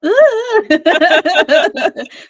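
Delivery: high, loud giddy laugh. Real, not acted. Guest laughing after her dramatic quote reading, high and giddy. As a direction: burst out laughing at your own reading.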